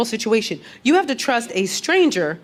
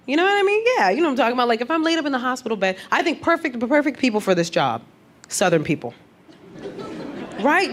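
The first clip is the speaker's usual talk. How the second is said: ordinary delivery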